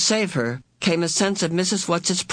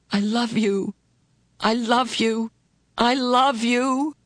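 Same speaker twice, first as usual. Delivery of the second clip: each I love you climbing higher; rising tearful plea; 6 semitones higher than usual. A narrator's reading. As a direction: pour it out, building in pitch. Meg repeats her love, rising.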